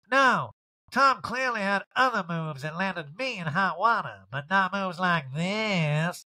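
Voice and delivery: Erica. Retold or imitated. Erica jabbing at Tom in an interview, slow put-on voice. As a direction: drag it out in a mock-diva drawl. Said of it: "Erica immediately responds".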